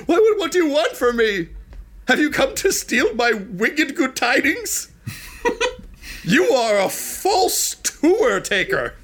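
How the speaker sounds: very high, drawn-out accusing wail; high-pitched comic panic, words stretched; panicked Iggy. Acted. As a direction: squawk in hammed-up distress.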